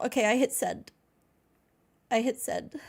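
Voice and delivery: ordinary talk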